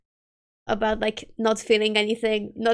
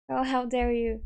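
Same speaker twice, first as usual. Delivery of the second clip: ordinary talk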